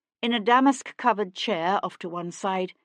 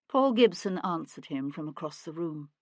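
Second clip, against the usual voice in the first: ordinary delivery, nothing marked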